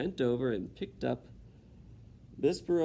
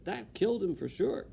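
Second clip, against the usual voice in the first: put-on higher simple girl voice; Mig's simple voice; 6 semitones higher than usual. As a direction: say it plainly certain. Mig speaking.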